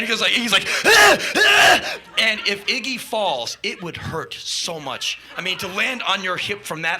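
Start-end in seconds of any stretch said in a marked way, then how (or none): 0.8-2.0 high, loud strained yelps; imitated thrusting grunts. [name] keeps humping the amps, loud yelps.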